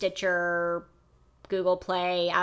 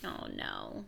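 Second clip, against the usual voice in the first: whisper; 17 dB quieter, slower than usual. Pretend. Whispered mock eulogy.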